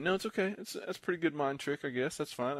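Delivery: high-pitched imitation of a put-out opponent; mock conceding. Imitating upset opponent conceding after trick.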